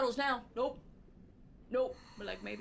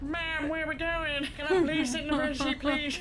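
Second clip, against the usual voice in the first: higher, louder pleading puppy voice; dog's inner voice; 4 semitones higher, 7 dB louder than usual. Pretend. Voicing a dog begging for the front seat, pleading. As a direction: beg politely like an eager dog.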